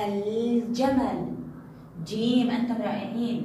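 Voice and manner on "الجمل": "الجمل" very slow, with a long ل; pronounced lam. Shown as a demonstration. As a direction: hold the L of الجمل, then sound the ج. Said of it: "إنه حرف الجيم من حروف اللام القمرية".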